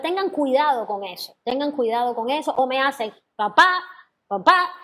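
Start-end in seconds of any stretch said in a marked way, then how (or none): 3.4-4.7 short "pa", then a loud punched "pa"; lopsided "pa-PA" rhythm demo; wrongly accented eighths. Shown as a demonstration. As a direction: hit the second "pa" of each pair hard. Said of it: "Eso no son dos corcheas".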